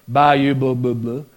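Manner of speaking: low, mumbled imitation of a heavy Cajun accent; mock Cajun accent. Preacher imitating a missionary he met, mock Cajun accent. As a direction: make fun of a thick Cajun accent, words turning to gibberish. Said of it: "the heavy Cajun accent".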